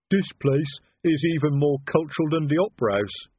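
lumbering troll admiring the place; Detritus, respectful troll. A narrator's reading. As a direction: marvel respectfully as a simple troll. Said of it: "gazing around respectfully".

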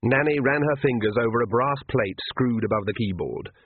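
ordinary delivery

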